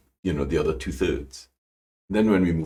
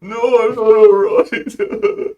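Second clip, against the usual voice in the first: loud, high, drawn-out mock wail; acted tearful wail; 10 dB louder, much slower than usual. She answers Tim at home, hammed-up tearful wail. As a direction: wail it slowly, hamming up the upset. Retold breakdown on reaching home.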